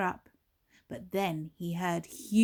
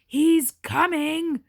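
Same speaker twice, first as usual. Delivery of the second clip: loud, higher, slow warning; alarmed cry; 7 semitones higher, 10 dB louder, slower than usual. Acted. Giantess warning Jack the giant is coming, loud and alarmed.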